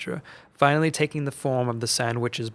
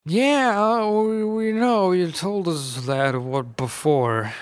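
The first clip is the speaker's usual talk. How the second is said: put-on higher, slow old man voice, weary; exasperated Uncle Seamus; 6 semitones higher, 3 dB louder, slower than usual. A narrator's reading. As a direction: point out the obvious. Uncle Seamus answering the witch.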